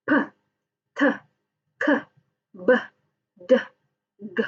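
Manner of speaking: isolated bursts "P, t, k, b, d, g"; short separated "P, t, k, b, d, g", no letter names; plosive stops. Shown as a demonstration. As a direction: pop each of "P, t, k, b, d, g" with a gush of air. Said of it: "it is called stops".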